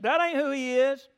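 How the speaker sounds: high, loud protest from the pews; retold yell. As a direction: imitate an indignant heckler refusing to hush. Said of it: "just yelled it out".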